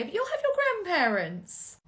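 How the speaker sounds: ordinary delivery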